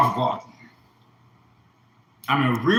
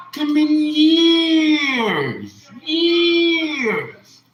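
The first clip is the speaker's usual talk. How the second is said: much higher, louder, very slow crying; crying wail; 13 semitones higher, 6 dB louder, much slower than usual. Real, not acted. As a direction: let years stretch into a wail. Crying over long suffering.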